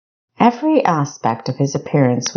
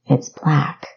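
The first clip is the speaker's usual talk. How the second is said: quiet whispered reply. Acted. Equestria answering Kessa in a whisper. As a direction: whisper back calmly. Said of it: "Equestria replied quietly".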